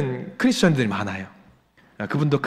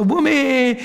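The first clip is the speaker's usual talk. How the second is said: slow, much higher Jeolla drawl, lightly mocked; imitating Jeolla dialect; 9 semitones higher, much slower than usual. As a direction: savour the kimchi in a slow Jeolla accent. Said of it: "필리핀 분이 이야기합니다". (느리고 높은 전라도 사투리 흉내)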